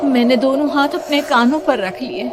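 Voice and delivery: ordinary delivery, nothing marked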